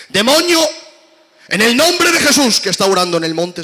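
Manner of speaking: loud high exorcist yell; frantic disciples shouting at the demon; shouting like mad. Retold or imitated. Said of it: "empiezan a gritar como locos".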